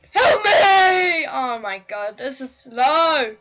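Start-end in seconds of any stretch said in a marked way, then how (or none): 0.1-1.3 loud laughing cry for help; laughing scream. Real, not acted. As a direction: panic through laughter. Chased, panicking in game.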